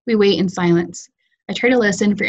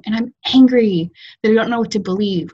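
plain reading voice

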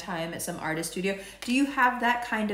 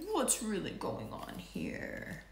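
slow unvoiced whisper, softer; whispered Saturn; 5 semitones lower, 11 dB quieter, slower than usual. Saturn whispering a probing question to you. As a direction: breathe the question like a probing planet.

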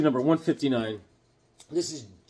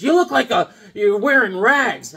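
mock yell; 7 semitones higher, 8 dB louder than usual. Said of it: "Hawkwoman's always yelling at me".

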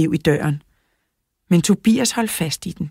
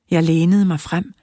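ordinary delivery

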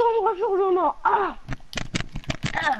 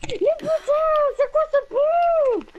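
raging gamer; 8 semitones higher than usual. Real, not acted. Gamer angry at the shotgun.

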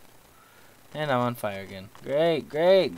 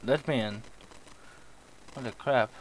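usual speaking voice

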